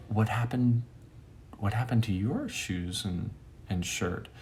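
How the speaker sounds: soft, hesitant asking; gentle question. Retold or imitated. Storyteller asking the shirtless man, gently hesitant.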